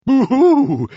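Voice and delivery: high, loud owl hoot; frightened owl. Acted. Owl sees the Gruffalo, high frightened hoot. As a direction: hoot out in alarm.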